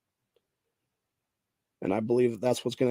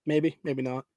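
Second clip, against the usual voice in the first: ordinary talk, nothing marked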